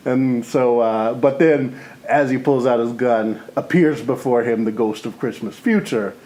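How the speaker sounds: usual speaking voice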